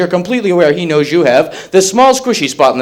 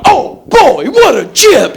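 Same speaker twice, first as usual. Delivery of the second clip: much higher, fussy delighted voice; a twee man gushing over a chip; prissy Tony Randall; 10 semitones higher, 3 dB louder, much slower than usual. Acted. Tony Randall playing Rambo, high and prissy.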